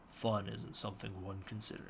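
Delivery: attempted low, quiet villain voice; half-hearted deep impression, softly delivered; Thanos. Shown as a demonstration. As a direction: try a low voice without much conviction. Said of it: "think of something to say as Thanos".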